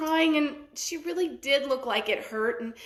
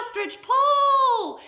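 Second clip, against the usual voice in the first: high, loud, slow pull call; group cheering; 12 semitones higher, 6 dB louder, much slower than usual. A narrator's reading. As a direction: call out encouragement. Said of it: "other animals started calling too".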